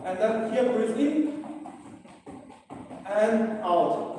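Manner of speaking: loud calls over the effort of jabbing; shouted breathing cues. Real, not acted. Trainer calling breath cues to class mid-workout, loud. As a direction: bark each in and out while punching.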